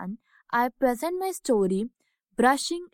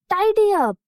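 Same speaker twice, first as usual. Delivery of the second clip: bright call, higher and louder; 8 semitones higher, 6 dB louder than usual. Acted. Sister reminds the family before the story, bright loud call. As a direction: sing out the chore, higher and louder. Children's bedtime chores reminder.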